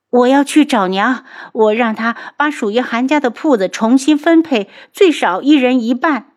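high, insistent quarrelling voice; indignant, high-pitched insistence; angry demand. Acted.

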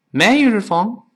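fast, linked casual English; fast casual speech. Shown as a demonstration. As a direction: toss it off quickly like everyday talk. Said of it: "语速特别快，往往会出现这么一个声音".

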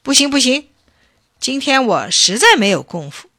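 loud, put-on protest; deliberate refusal. A narrator's reading. Bacang deliberately stalls the eager chief, protesting. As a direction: refuse loudly, laying it on. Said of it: "巴仓又故意说".

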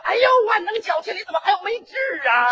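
high, fast, alarmed cry; alarmed bride. Acted. Bride alarmed at the word spoken in the car, crying out high and fast. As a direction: yelp the question in alarm.

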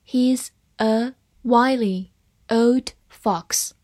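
very slow, one word at a time; slow read-along. Shown as a demonstration. Teacher models the fox sentence for students, slow and separated. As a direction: give each word its own space for learners to repeat. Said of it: "慢慢来读".

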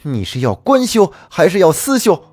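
high, loud threatening challenge; gripping her wrist, he threatens her; angry demand. Acted. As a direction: corner her with the question.

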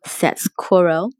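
ordinary delivery, nothing marked

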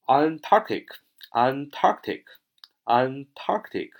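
Antarctic said slowly, stress on the second syllable ARC. Shown as a demonstration. Teacher drilling vocabulary for class, slow stressed Antarctic. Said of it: "重音在它那儿啊".

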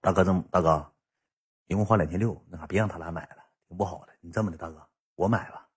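low, soft, halting voice; imitated sheepish plea. Yuntao sidling up to his big brother about the bill, imitated sheepish plea. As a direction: plead small and quiet, almost under the breath. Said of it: "云涛上我跟前这么跟我说句话".

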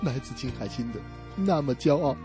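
normal talking voice

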